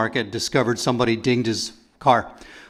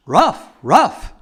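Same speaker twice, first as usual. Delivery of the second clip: raised, barky rough rough; dog bark; 5 semitones higher, 4 dB louder than usual. Pretend. Comedian as a dog inspector, mock barking. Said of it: "Here's a dog as quality control".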